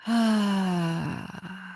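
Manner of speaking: long sighing breath out; audible exhale. Real, not acted. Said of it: "Audible exhale out".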